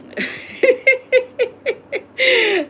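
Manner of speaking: high, loud knowing laugh, caught by a private joke. Real, not acted. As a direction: burst out laughing at a secret joke. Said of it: "you know why I'm laughing".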